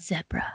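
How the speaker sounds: "Zebra" with a short e, as "zeb-ruh"; South African zebra. Retold or imitated. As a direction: say "Zebra" rhyming its first syllable with "web". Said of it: "He says zebra".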